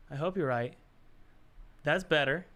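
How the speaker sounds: ordinary delivery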